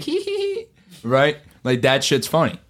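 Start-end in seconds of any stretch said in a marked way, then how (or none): 0.0-0.7 very high, soft mock giggle; imitated chef's giggle. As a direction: squeal out a tiny put-on hee-hee. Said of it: "He's like".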